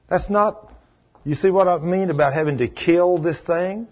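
ordinary talk, nothing marked

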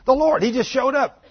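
high, loud, breathless announcement; excited news. Acted. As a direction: blurt it out like news too good to hold. Disciples bursting in with news.

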